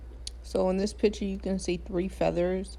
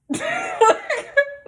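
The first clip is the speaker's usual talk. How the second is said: loud, high burst of laughter; freaked-out laugh; 8 dB louder than usual. Real, not acted. Recalling the feather at her foot, freaked-out laugh. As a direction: let out a high, excited laugh. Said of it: "I started freaking out".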